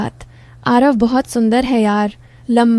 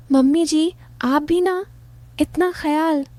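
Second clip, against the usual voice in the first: higher, softening coy reply; a hint of a coaxing son-in-law, pitched up; lightly voiced son-in-law; 5 semitones higher, 3 dB quieter than usual. A narrator's reading. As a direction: lift the pitch and let it soften.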